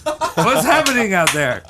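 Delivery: high, fussing voice to a dog; worried mom to dog. Acted. Mom asking her dog what is wrong, high and fussing. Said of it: "And she's like".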